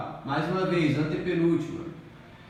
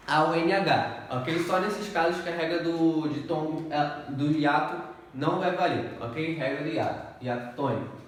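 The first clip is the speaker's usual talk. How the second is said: usual speaking voice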